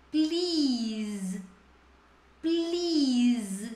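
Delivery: "Please" ending on a buzzing z; Z sound at end. Shown as a demonstration. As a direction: stretch "Please" and voice the final s as z. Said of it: "Bem no som de Z". ("Please" bem no som de Z)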